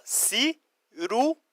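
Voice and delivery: "ciruela" slowly split si-ru-e-la; syllable by syllable. Shown as a demonstration. Teacher shows students Spanish for plum, slowly syllabified. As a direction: break "ciruela" into slow separate syllables. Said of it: "Ó".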